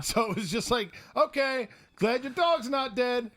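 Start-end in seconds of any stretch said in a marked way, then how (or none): 1.1-3.3 loud, high, drawn-out farewell; high mock-cheerful shout; hammed-up sign-off. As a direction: call it out slowly across the yard. Acted hasty farewell in retelling.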